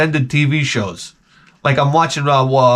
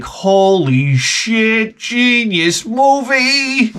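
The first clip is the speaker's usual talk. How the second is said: high, very drawn-out exclamation; climactic awe; 7 semitones higher, much slower than usual. Retold or imitated. Dad as the music peaks, climactic exclamation. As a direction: stretch every word out over the peaking music. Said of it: "the music is peaking".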